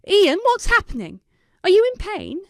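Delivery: high, loud, anxious questions; frightened for her brother; worried. A narrator's reading. Sister hears sick brother.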